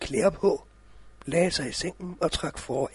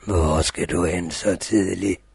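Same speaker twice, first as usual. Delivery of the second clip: wolf voice; 11 semitones lower, 5 dB louder than usual. Acted. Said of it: "the wolf questions Rødhætte".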